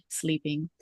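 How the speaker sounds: ordinary talk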